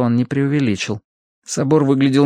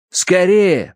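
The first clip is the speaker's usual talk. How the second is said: urgent, higher-pitched call; hurrying someone; 8 semitones higher, 4 dB louder than usual. A narrator's reading. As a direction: urge the servant on. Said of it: "поторопил я Бэйна". (торопя, высоким голосом)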